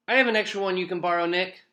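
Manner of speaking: high, loud, rushed blurt. A narrator's reading. John calls out to Nick across the classroom, blurting it out. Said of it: "John blurted out".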